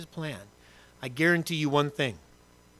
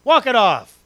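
higher, louder mock-dismissive dad; gruff dad; 7 semitones higher, 7 dB louder than usual. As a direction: brush off the fallen kid. Dad dismisses hurt kid.

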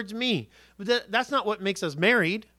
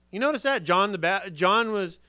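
ordinary talk

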